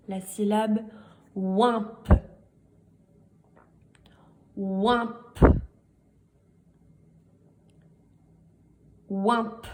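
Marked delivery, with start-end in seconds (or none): 1.3-9.7 "oimp" with a separate, popped final P; dictated syllable. Shown as a demonstration. Teacher dictating a syllable three times, "oimp" with crisp P. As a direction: sound out "oimp", releasing the last P clearly. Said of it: "je vais vous les répéter trois fois".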